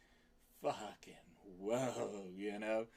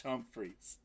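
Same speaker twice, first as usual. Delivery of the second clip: normal talking voice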